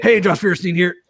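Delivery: a light impression of John the guest; host lightly imitating guest's intro; imitating John's voice. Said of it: "you're pretty good".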